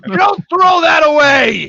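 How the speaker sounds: slow, high shouted plea; mock-anguished fatherly roar; hammed-up dad's yell. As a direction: bellow it with the last word stretched out. Dad yelling the punchline at son.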